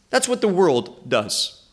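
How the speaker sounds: ordinary delivery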